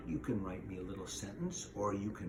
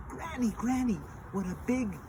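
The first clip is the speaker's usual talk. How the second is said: put-on high little-girl voice; 12 semitones higher, 3 dB louder than usual. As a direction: pipe it up high to Granny.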